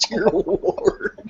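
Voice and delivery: high, laughing "dear lord"; buddy laughing in disbelief at the moose tracks. Retold or imitated. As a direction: gasp it out through a laugh. Buddy's shocked reaction, laughing.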